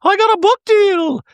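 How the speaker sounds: very high, loud, squealing mock Dorit; high mocking impression of Dorit announcing news. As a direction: shriek it like a giddy caricature. Dorit voiced, high put-on voice.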